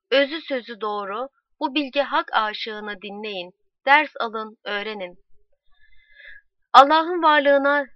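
loud public proclamation; town crier. Acted. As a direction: call out to the whole street. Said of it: "yüksek sesle halka şöyle seslendi". (yüksek sesle tellal ilanı)

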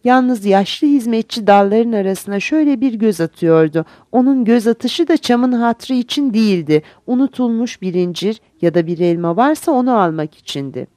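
plain reading voice

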